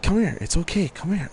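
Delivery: high, soothing coax; coaxing a scared dog. Retold or imitated. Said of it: "and I'm like".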